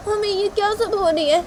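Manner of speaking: high-pitched dismayed appeal; high, upset daughter; dismayed Tisha. Acted. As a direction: appeal to mother, voice pitched right up.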